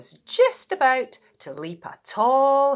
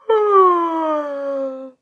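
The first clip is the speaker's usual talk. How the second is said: loud, high stretching yawn; 7 semitones higher, 8 dB louder than usual. Acted. Mole catching the yawn from Dog. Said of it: "Mole yawned too".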